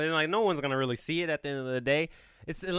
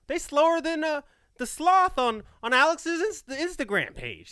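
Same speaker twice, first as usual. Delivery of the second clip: normal talking voice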